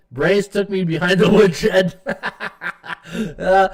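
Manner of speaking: laughing. Real, not acted. Reading viewer's chat, laughing.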